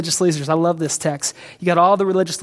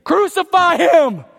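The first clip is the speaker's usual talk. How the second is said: high, loud, slow angry crowd yell; crowd demand; 12 semitones higher, 7 dB louder, slower than usual. Retold or imitated. Preacher voicing the crowd turning on Jesus, loud slow high yell. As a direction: call for his death like a mob. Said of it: "to saying".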